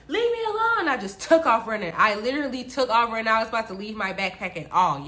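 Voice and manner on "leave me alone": high-pitched mock scream; panicked high cry to be left alone; retold scream. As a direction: shriek it at moderate volume. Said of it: "And I screamed".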